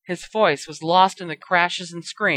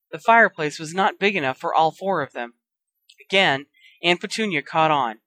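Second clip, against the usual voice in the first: usual speaking voice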